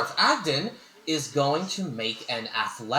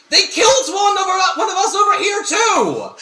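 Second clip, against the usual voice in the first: loud, high falsetto holler; alarmed yell; 7 dB louder than usual. Acted. Bandit calls over from elsewhere, alarmed yell. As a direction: call out high and loud from afar.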